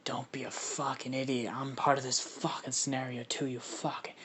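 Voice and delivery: quiet, whispery irritated creature; Carnage. Acted. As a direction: scold in a hushed monster rasp. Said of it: "Carnage pops up in his head".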